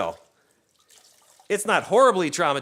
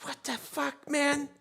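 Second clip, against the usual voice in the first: hushed disbelief; 8 semitones higher, 7 dB quieter, slower than usual. Retold or imitated. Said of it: "It's like".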